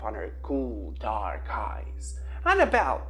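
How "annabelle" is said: sharp loud scolding call; reprimanding. A narrator's reading. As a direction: scold the hen by name. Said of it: "Lil reprimanded".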